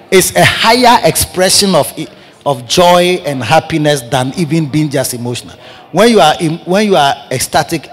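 ordinary delivery

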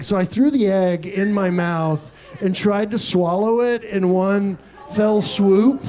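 usual speaking voice

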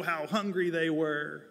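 ordinary talk

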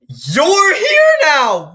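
slow, loud, high joyful cry of a mother; overjoyed mom. Acted. Mother reunited with her child, loud and slow. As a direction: stretch it out in delight.

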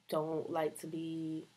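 normal talking voice

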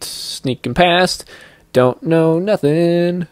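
sneaky slow drawl. Pretend. Gamer sneaking past guards, slow mock-stealthy voice. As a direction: stretch each word out like tiptoeing.

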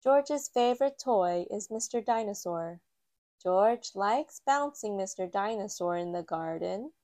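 plain reading voice

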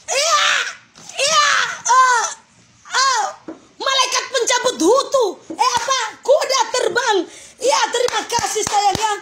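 loud, high-pitched burst of excitement; excited shouting. Real, not acted. Streamer to viewer sending gifts, excited shouting. Reacting to big livestream gifts.

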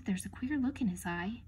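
plain reading voice

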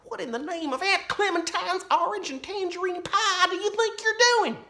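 very high squeaky flustered voice; alarmed dad. A narrator's reading. Laura's dad frets at his daughter, very high. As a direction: scold her in a panic.

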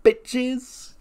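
sassy high-pitched "sup" flung out loud; mock brash high-voiced hello; brash greeting. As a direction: toss it off like a cocky heel. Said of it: "And she's like".

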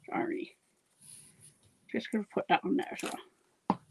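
talking with mouth full; words round a bite of food. Real, not acted. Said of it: "Shouldn't have talk with your mouth full".

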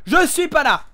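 real frantic shouting in game; yelling. Real, not acted. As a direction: shout at teammates in a panic. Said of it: "je gueule".

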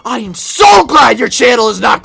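an all-out rant; furious shout. Acted. Raging at the other commentator.

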